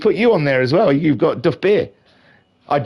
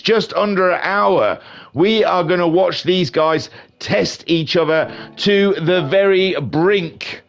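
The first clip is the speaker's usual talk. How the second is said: race announcer; 5 semitones higher than usual. Acted. Commentator introduces the challengers to viewers, grand announcer build-up. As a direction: hype the contest, stretching the final words.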